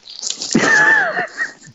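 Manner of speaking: loud high burst of laughter. Real, not acted. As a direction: roar with laughter. Big laugh.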